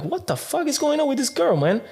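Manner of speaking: high, incredulous retelling; baffled outrage. Retold or imitated. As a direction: act out his disbelief. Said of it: "I'm like".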